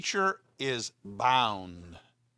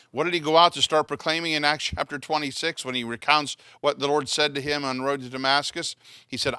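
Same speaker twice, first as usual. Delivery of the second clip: ordinary delivery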